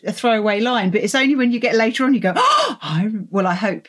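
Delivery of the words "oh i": gasping realisation. Retold or imitated. Reader's realisation acted.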